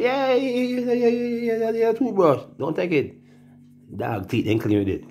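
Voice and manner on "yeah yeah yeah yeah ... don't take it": high, squeaky teasing yeahs; sing-song ridicule of the returnee; mocking high voice. Mocking returnees with new toothbrushes, high teasing voice.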